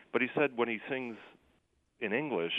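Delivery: ordinary talk, nothing marked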